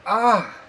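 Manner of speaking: sharp loud yelp; pained yelp. Real, not acted. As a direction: yelp at the burning heat. Burns mouth on hot food.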